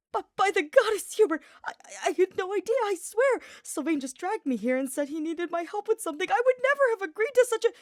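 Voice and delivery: high, loud, frantic pleading; horrified panic. Acted. Archer pleading his innocence to Hubert in a high, frantic panic. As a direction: babble out a desperate excuse. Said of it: "blanched with horror".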